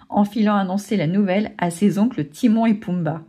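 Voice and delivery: ordinary delivery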